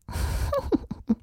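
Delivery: high, breathy giggle. Acted. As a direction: giggle like a mischievous spirit. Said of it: "Mister Whisper kicherte nur".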